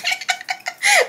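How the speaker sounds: high, squeaky wheezing giggle; imitated laughing. Showing how she and her friend kept laughing, imitated laughing. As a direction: act out a helpless giggle. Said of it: "me and Chelsea, we're laughing".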